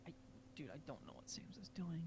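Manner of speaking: hushed whispered aside; whispering. Real, not acted. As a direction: whisper it so the others miss it. Aside to teammate.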